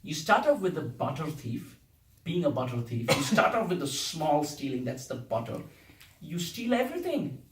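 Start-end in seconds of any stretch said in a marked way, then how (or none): none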